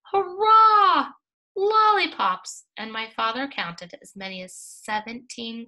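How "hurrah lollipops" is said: much higher, loud crocodile cheer; chorus shouting. A narrator's reading. Seventeen crocodiles cheering for lollipops, loud high shout. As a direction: cheer it out like a crowd of thrilled crocodiles. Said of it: "voices shouted".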